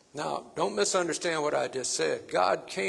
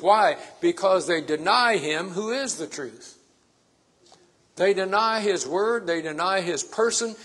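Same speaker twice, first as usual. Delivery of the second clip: loud, raised-pitch sermon delivery; emphatic preacher building on repeated phrases; forceful preaching; 3 semitones higher, 5 dB louder than usual. Real, not acted. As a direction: drive each point home to the congregation.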